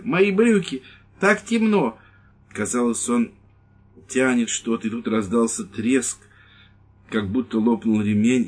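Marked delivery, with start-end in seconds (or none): 0.0-2.0 high, loud fluster in the dark; flustered old man. Acted. Priest fussing over his trousers in the dark, high and loud. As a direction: fumble and fret aloud.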